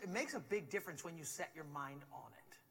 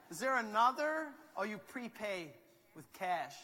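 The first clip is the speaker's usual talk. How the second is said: ordinary delivery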